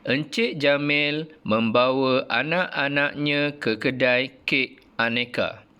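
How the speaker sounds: slow, careful reading aloud for pupils; model reading intonation. Shown as a demonstration. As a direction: read it slowly with clear rises. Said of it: "Sekali lagi cikgu baca". (bacaan contoh dengan intonasi yang jelas)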